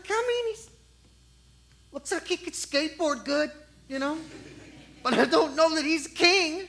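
high, hesitant hedging voice; doubtful prophet. Acted.

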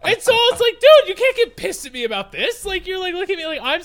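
high, loud exasperated rant; fed-up rant to friends; indignant rant. Real, not acted. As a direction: vent loudly at the teacher. Venting at teacher.

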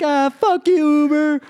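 high, slow, loud taunting jeer; mock group jeer. Staff laughing at the Uber driver, loud slow jeer. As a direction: jeer at him through the window.

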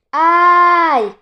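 high, loud, long-held yell; drawn-out high shout to stop; shouted command. A narrator's reading. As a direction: bellow a halt at a runaway horse. Rider commands galloping horse to halt.

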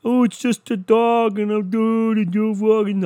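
high, slow, mocking brush-off; mock tough joking. Joking to listeners that the dog didn't matter, high and mocking. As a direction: shrug it off in a silly high voice. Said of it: "I did the jokes like".

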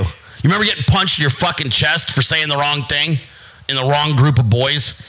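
normal talking voice

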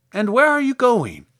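high, loud scarecrow question; curious scarecrow. Acted. Scarecrow asks the girl her destination, curious. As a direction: pipe up inquisitively.

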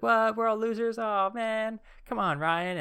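squeaky high mock whine of a friend. Friend finding the list in his desk, high mock whine. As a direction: play the friend as a high fake protest. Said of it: "hoping he'd discover it and be like".

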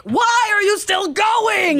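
high, drawn-out wail of frustration; mock-yell in disbelief at endless crying; exasperated shout. Voicing exasperated parent to crying baby.

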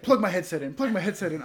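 fired up, wants to fight back; angry demand. Retold or imitated.

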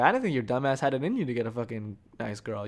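normal talking voice

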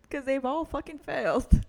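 laughing loudly through it. Real, not acted. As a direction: blurt it out while cracking up. Laughing at own failed relationships.

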